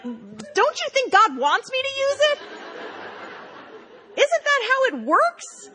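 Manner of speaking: high, slow, pleading; high wheedling plea. Retold or imitated. Tipsy writer pleads with Carla for her story, high and wheedling.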